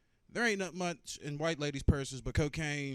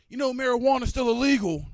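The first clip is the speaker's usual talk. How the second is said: loud, high yelled warning; old neighbor yelling; 8 semitones higher, 11 dB louder than usual. Retold or imitated. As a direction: yell it across the yard like a grumpy old vet. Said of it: "old dude yell out".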